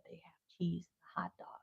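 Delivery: hushed whispered confession. Retold or imitated. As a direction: whisper it like a scandal. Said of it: "I said".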